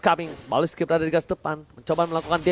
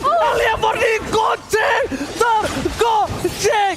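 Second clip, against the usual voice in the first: fighting game announcer; 11 semitones higher, 3 dB louder than usual. Real, not acted. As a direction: hype building to shouted climax, much higher. Peak of live teamfight commentary.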